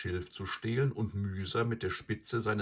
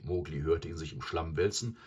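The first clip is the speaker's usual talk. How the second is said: usual speaking voice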